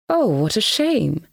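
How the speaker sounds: slow, mildly disappointed sigh of a line. A narrator's reading. Koroviev feigns disappointment at the woman's rebuff, slow. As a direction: let the letdown linger, slowly. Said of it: "in a disappointed voice".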